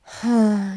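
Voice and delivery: loud, rising sigh of pleasure. Acted. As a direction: let out a long pleasured sigh.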